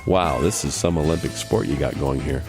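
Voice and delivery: usual speaking voice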